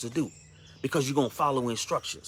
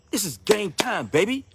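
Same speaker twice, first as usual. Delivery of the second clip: higher, energetic rally cry; hyped-up; 6 semitones higher than usual. Real, not acted. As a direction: fire them up.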